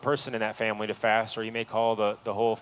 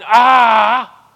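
loud, high, exasperated "ah"; mock exasperated yell; 13 semitones higher, 18 dB louder than usual. God pictured as yelling.

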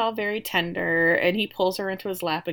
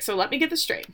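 higher-pitched, disbelieving wife; lightly acted incredulity, pitched up; mock incredulous; 7 semitones higher, faster than usual. Wife working out her husband's excuse, mock incredulous. Voicing wife's incredulous reckoning.

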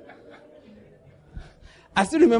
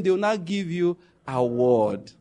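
usual speaking voice

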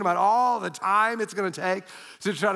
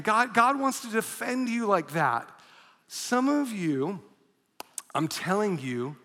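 usual speaking voice

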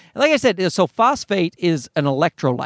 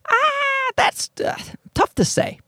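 hesitant doctor; slower than usual. Retold or imitated. Doctor hesitating over his question.

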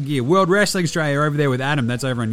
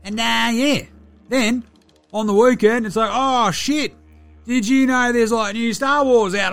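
higher-pitched ocker larrikin, casually enthusiastic; Delly, laid-back Aussie mate; 6 semitones higher than usual. Acted. Player checking in with fans to review a show, laid-back ocker mate. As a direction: play a chilled, sweary bloke reviewing telly.

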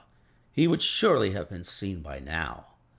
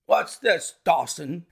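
louder, higher demand of the groom; sharp cry; 6 semitones higher, 3 dB louder than usual. A narrator's reading. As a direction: call out to catch the servant at it. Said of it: "he cried".

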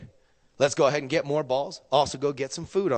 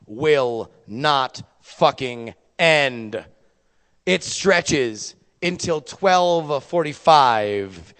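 slow, drawn-out storytelling; slow dramatic telling; much slower than usual. Acted. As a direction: stretch it out for drama.

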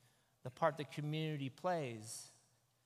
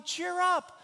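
mock cheery; 14 semitones higher, 6 dB louder than usual. Crowd suddenly cheering him.

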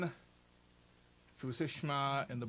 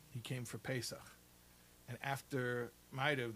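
ordinary talk, nothing marked

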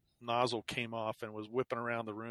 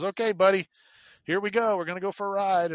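higher, louder imitation of an upbeat nurse; chirpy nurse; 8 semitones higher, 7 dB louder than usual. Nurse collecting a patient for a ride, cheery imitation. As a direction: call out brightly to the patient, mimicking her.

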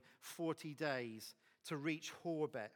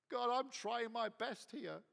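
whiny complaint to God; 7 semitones higher than usual. Acted. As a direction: whine your list of grievances at God. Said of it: "I get like that sometimes".

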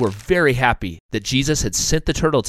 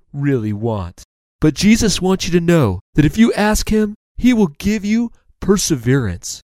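higher-voiced turtle teacher; kindly turtle, encouraging; 6 semitones higher than usual. Turtle encourages tired little ants, encouraging.

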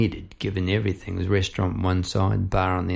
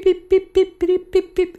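very high little bird chirps; finch chirps; 5 dB louder than usual. Retold or imitated. Telling about the escaped finch, very high chirping beeps. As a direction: chirp like a tiny zebra finch. Imitating finch fleeing the net.

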